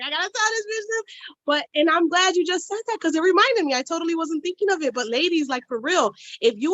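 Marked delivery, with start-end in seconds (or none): 0.0-1.0 eager. Retold or imitated. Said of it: "I'm like".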